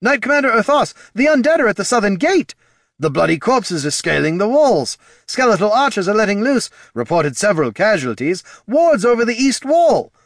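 high, loud, breathless battle report; urgent alarm. A narrator's reading. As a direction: shout the bad news all at once. Officers shouting urgent battle reports.

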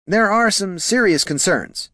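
high-pitched, cautious subordinate voice; deferential junior clerk. Acted. Clerk raising concerns with his two bosses, deferential. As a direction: broach it politely, pitched high.

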